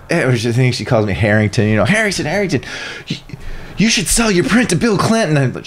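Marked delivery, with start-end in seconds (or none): 1.9-5.4 imitating an excited, high-pitched student. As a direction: urge him on in a high, eager voice. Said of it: "she's all excited".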